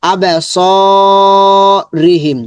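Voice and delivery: "স" held long between short syllables; tajweed drill stretching "স"; lengthened vowel. Shown as a demonstration. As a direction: draw out "স" in "আবে স রি হিম". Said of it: "এটা লম্বা হবে".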